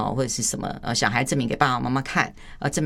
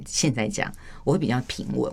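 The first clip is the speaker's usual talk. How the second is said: ordinary talk, nothing marked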